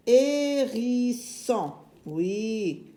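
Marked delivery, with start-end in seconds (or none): 0.1-1.7 very slow, drawn-out "Hérisson"; "Hérisson" sounded out at half speed; syllable by syllable. Shown as a demonstration. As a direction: stretch each syllable of "Hérisson" for young readers.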